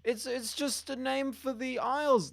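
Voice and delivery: high-pitched mock official excuse; imitated brush-off, pitched high; dismissive government denial. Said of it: "They'll just say".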